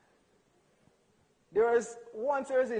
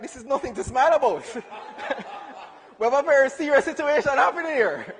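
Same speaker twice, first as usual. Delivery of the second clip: louder, laughing through mock-serious protest; mock outrage; 4 semitones higher, 9 dB louder than usual. Son to smiling mother, loud laughing mock indignation. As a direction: play the wronged son, half laughing.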